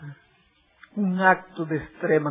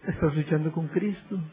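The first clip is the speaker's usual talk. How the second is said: normal talking voice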